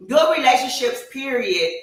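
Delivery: corrected line, now with full weight on Good; emphatic restart. Shown as a demonstration. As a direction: lean hard on Good and land period firmly. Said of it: "No, let me take that back".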